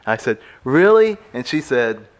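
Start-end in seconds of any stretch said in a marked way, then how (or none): 0.6-1.2 high, louder amazed question; mock amazed. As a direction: sound wide-eyed. Retold amazed self.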